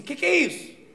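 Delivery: a woman startled, asking what is happening; mock alarmed question. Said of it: "ela chegou pra mim e falou assim".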